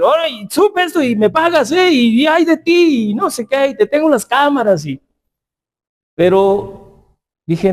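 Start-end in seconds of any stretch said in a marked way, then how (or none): 0.0-4.8 higher-pitched threatening rant to the parent; mock scolding. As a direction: pretend to threaten the mother, lightly acted. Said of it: "a decir". (regaño amenazante fingido, más agudo)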